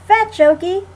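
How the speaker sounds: loud, high call to a dog; shouting. A narrator's reading. Annie shouting to Okie to fetch the ball. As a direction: shout it across the yard. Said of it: "Annie shouted".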